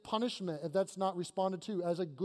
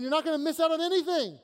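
higher and louder, calling out with full conviction; fervent preaching voice; 8 semitones higher, 6 dB louder, faster than usual. Acted. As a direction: proclaim it like a promise to the crowd. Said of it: "He's saying".

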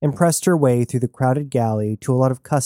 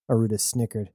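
ordinary delivery

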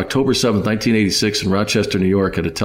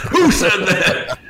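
mock fired-up; 10 semitones higher, much slower than usual. Mimicking Jim fired up.

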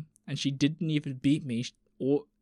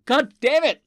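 loud, high cry of exasperation; frustrated outburst; 10 semitones higher, 8 dB louder than usual. Real, not acted. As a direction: burst out cursing in frustration. Frustrated at not screening post.

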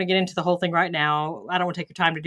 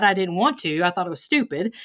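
ordinary talk

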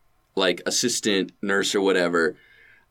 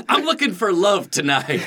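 laughing; 9 semitones higher than usual. Acted. Acting drugged patient, laughing.

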